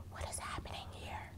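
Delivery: very soft, voiceless whisper; barely audible, bewildered aside; baffled whisper. Retold or imitated. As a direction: whisper it in hushed confusion. Baffled reaction to unbroken radio.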